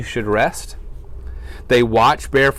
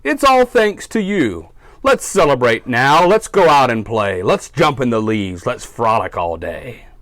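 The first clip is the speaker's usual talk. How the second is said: louder, bouncing, joyful shout; excited bear; 6 dB louder than usual. Acted. Bear cries to his friends that he is all better, loud and excited. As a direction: burst out like you just got well. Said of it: "Bear cries".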